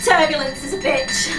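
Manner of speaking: usual speaking voice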